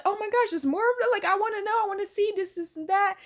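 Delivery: high, excited gushing; playing a thrilled, pleading girl; imitating eager excitement. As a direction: rush in with high-pitched curiosity. Girl eager to see more, retold.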